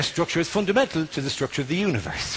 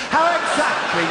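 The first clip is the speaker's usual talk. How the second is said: outraged shout; 8 semitones higher than usual. Real, not acted. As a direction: bark the question in disbelief. Ranting at the plot.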